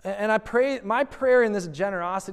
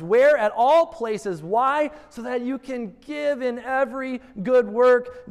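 louder call and answer preaching; 5 semitones higher, 4 dB louder, slower than usual. Acted. Pastor questioning and answering his church, ringing.

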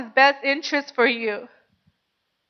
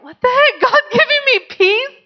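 laughing disbelief; 10 semitones higher than usual. Retold or imitated. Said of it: "But then I was like".

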